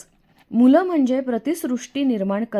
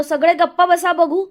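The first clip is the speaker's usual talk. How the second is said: raised, higher voice over the din; shouting; 8 semitones higher, 6 dB louder than usual. A narrator's reading. Boy hushing the noisy crowd of children, shouting. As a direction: shout for order, louder and higher. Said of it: "शेवटी मी ओरडलो".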